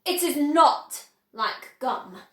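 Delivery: slow, firm, stressed IS; offended inventor setting her straight; indignant correction. Acted. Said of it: "Mister Wonka said".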